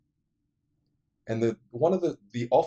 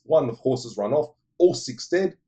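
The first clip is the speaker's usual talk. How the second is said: ordinary delivery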